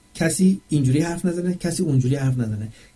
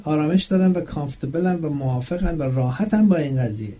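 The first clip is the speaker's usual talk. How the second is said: ordinary talk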